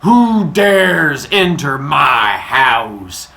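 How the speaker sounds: very loud, slow, drawn-out god voice; booming voice. Acted. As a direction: boom it out like an angry god, stretching every word. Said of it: "he hears a booming voice say".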